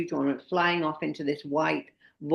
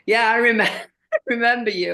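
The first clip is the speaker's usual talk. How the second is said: higher, louder guide voice breaking into a laugh; imitating the guide; 6 semitones higher, 6 dB louder than usual. Guest imitates Johnny remembering her, higher and laughing. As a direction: play the cheery Russian guide recognising her. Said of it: "he went".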